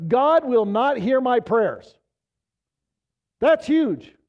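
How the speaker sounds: loud, rising sermon fervor; preaching at full force. Acted. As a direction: drive it home to the congregation. Preaching climax on prayers.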